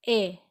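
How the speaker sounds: "A" as the vowel of règle; French è sound. Shown as a demonstration. Teacher picking out the accent grave sound, "A" on its own. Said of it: "It sound like".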